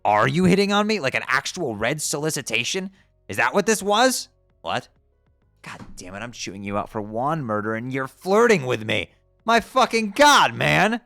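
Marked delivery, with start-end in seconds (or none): 0.0-4.3 loud, high incredulous questions; loud shocked accusation; outraged disbelief. Acted. 9.5-11.0 loud, high, slow exasperated cry; loud drawn-out exasperation; exasperated yell. Acted. Exasperated outburst.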